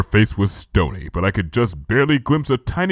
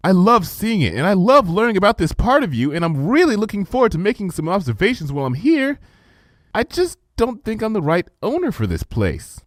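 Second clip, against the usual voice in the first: put-on higher, earnest young voice; a hint of anxious student declining a gift; lightly voiced young mare; 9 semitones higher, 3 dB louder than usual. A narrator's reading. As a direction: let her down gently and sincerely. Twilight declining gently.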